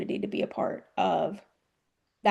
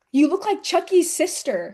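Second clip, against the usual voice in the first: mild mock yell; 6 semitones higher than usual. Wrestler retelling a fan's heckle to the host, mild mock yell. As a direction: imitate a yelling fan, higher without full volume. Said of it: "He yells".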